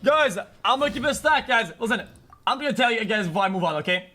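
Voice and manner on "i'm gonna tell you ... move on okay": ordinary talk, nothing marked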